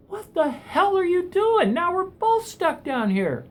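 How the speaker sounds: stuck man yelling at the guy who jumped in; shocked outburst. Acted. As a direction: shout it in total disbelief. Stunned as guy jumps in.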